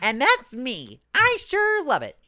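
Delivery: very high, loud put-on voice; mock gleeful fan. Podcaster mocking fans who like Vader's violence, mock gleeful. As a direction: squeal it as a hammed-up, delighted fan.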